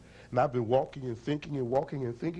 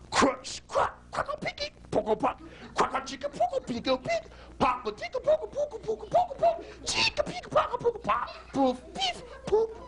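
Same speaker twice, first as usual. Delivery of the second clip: rapid high-pitched crackle and pop sounds; playful high nonsense sound effects; high squeaky crunching noises. Acted. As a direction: squeak out the horn breaking like a cartoon. Horn crushed, crackling sound effect.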